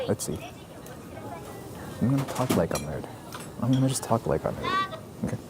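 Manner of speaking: low hushed whisper. Real, not acted. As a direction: keep it whispered. Whispering before ordering.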